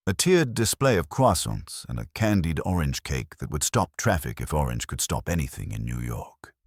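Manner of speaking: ordinary delivery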